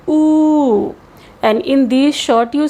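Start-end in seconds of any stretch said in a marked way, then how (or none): 0.1-1.0 U held long as "oo"; lengthened U. Shown as a demonstration. Teacher showing vowel length, long U. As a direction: draw the U out into a long oo. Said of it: "with a length".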